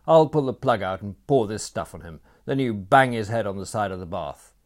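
loud, brusque orders; gruff command. A narrator's reading. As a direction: bark the instructions. Franz ordering Sebastian.

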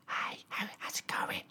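unvoiced whisper lost in noise; whispering hello at a loud party. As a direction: whisper a greeting nobody hears. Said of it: "in, like, a really loud room".

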